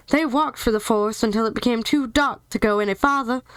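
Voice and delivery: ordinary delivery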